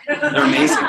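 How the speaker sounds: high, gushing praise of cars; mock gushing. Student parodying a bad speech, gushing high. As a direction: oversell it like a kid's speech.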